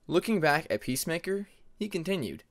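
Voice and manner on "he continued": usual speaking voice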